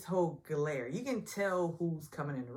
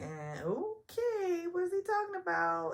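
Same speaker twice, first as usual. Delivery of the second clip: puzzled cast; 13 semitones higher than usual. Retold or imitated. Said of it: "everybody's looking like".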